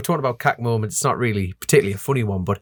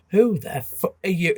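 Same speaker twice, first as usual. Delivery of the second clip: dad's bewildered snarl; 7 semitones higher, slower than usual. Retold or imitated.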